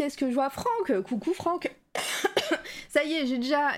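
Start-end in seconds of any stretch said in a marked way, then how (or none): 1.9-2.9 breathy throat cough. Real, not acted. Host with a frog in her throat, coughing. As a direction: cough through a scratchy throat. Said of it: "j'ai déjà un chat dans la gorge".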